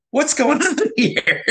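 high-pitched, laughing astonishment; mock astonishment with a laugh breaking in; retold surprise. Retold astonishment after transplant.

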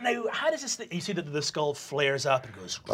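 ordinary talk

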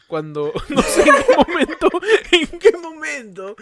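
voz aguda ahogada por la risa; hablar riéndose. Real, not acted. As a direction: intenta seguir hablando aunque te gane la risa. Se ríe buscando un ejemplo. (risa real, aguda y fuerte)

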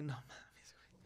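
breathless, barely voiced no mames; hushed horrified whisper; stunned whisper. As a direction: whisper in creeped-out disbelief. Reaction to son's ghost story.

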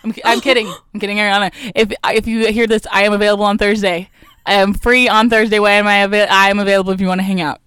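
loud playful plea; calling out. Real, not acted. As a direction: call out loud to someone far away. Shouting invitation to Ariana.

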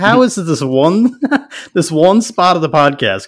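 high, loud, laughing protest; laughing disbelief. Retold or imitated. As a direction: laugh through the question in disbelief. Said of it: "I'm like".

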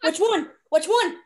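loud, high, eager asking; excited. Real, not acted. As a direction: demand the answer, thrilled. Eager demand for the title.

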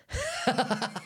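high-pitched burst of laughter; real laugh. Real, not acted. Talking alone, only six minutes in, real high laugh. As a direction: laugh helplessly in a rapid high run.

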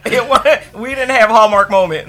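laughing. Real, not acted. Guest to host about the Hallmark joke, laughing. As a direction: laugh through the words.

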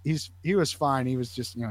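ordinary talk

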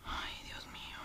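a voiceless, exasperated ay Dios mío; whispered sigh. Real, not acted. Vlogger losing patience searching for the film, whispered. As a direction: breathe it out as a whisper.

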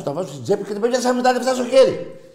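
high, loud, outraged yelling; furious shouting rant. Real, not acted. Angry pundit yelling at rival fans on air. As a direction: blast them with fury.

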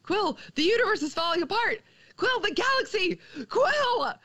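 high, urgent calls through a door; Groot calling out. Retold or imitated. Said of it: "from outside, we hear Groot".

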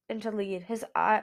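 usual speaking voice

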